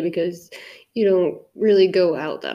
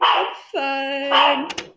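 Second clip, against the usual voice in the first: higher, exaggerated fake-brave reply; mock tearful cover-up; 4 semitones higher than usual. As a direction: act out hiding tears badly. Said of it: "Secretly just crying".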